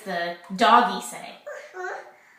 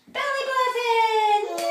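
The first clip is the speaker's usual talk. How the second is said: high, delighted cheer; excited cheer; 14 semitones higher than usual. Real, not acted. Parent cheering baby's body game.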